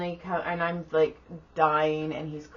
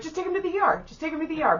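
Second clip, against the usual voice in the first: mock advice; 11 semitones higher, 3 dB louder, much faster than usual. Imitates partner's advice on phone.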